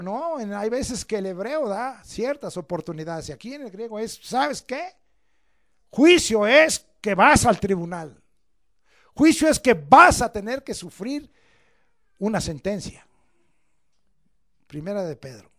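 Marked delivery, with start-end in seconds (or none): 4.2-13.0 stern preaching. Acted. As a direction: lay down the sentence. Loud slow preaching.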